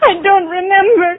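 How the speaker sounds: tearful denial, voice cracking; crying. Acted. Patient to the doctor, tearful. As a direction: choke out the denial through tears. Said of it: "You're crying".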